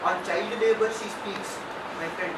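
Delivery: ordinary delivery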